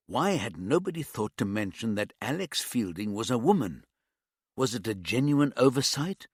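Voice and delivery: usual speaking voice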